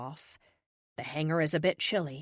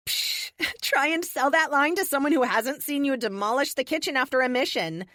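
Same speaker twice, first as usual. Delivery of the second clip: teasing teammate; 7 semitones higher, 4 dB louder than usual. Acted. Tessa teasing the speedster.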